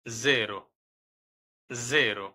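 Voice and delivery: জেরো with a dz sound; Italian word demo. Shown as a demonstration. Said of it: "জ এর মতো".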